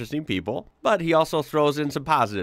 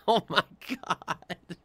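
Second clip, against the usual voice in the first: slow, higher words through a laugh; 7 semitones higher, 4 dB quieter, much slower than usual. Real, not acted. Vlogger laughing at the soldier calling German cars imports. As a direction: breathe it out amused and slow.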